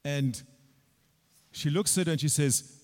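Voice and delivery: normal talking voice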